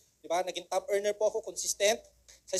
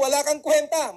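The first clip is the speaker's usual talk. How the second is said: mock scolding mother, louder; 6 semitones higher, 7 dB louder than usual. Mother calling child worthless, mock scolding. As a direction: scold like a fed-up mom.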